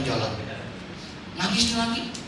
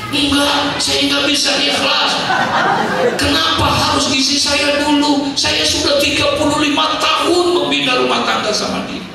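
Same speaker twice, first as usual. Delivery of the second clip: louder and higher, a hammed-up stubborn protest; mock loud refusal; 7 semitones higher, 6 dB louder than usual. Widower refuses the preacher's advice, mock loud protest. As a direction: shout it like a grieving man who won't give in.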